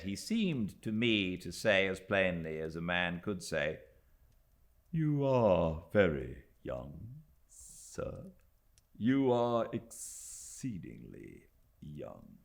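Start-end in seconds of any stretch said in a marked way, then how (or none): 4.9-12.4 unhurried, superior manservant; slow condescending valet. A narrator's reading. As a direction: talk down to the young guest slowly. Littimer's imagined condescending words.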